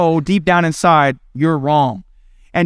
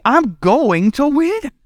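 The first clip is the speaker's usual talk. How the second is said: higher, slow, drawn-out parent vow; mock-stern parent, pitched up and slowed; determined parent; 7 semitones higher, much slower than usual. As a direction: stretch each word to show you will outlast them. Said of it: "And you look at".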